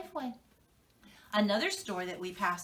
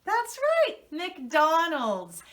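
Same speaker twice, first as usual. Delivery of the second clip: much higher and louder, thrilled; excited reveal; 6 semitones higher, 7 dB louder, much slower than usual. Shown as a demonstration. Teacher revealing the favorite lunch stop, thrilled and loud. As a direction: shout the big surprise to the kids. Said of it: "are you ready?".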